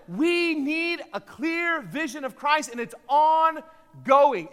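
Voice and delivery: building to loud, high, drawn-out proclamation; impassioned preacher rising to a shout; full-force preaching. Acted. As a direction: drive the point home, stretching the key words.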